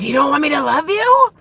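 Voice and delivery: high, loud, whiny and half-crying; clingy pleading lover. Retold or imitated. Mocking her old toxic self to her viewers, high loud whine. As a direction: plead like a needy codependent partner. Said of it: "It's like".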